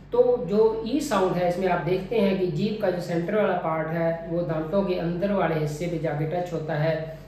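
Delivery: ordinary talk, nothing marked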